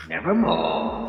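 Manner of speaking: low, dark raven voice; raven's croak. Acted. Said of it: "Quoth the raven".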